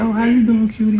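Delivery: high flirty woman voice. Retold or imitated.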